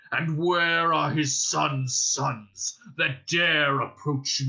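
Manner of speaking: slow, booming dragon boast; gloating dragon. Acted. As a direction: savour every threat as you gloat. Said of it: "he gloated".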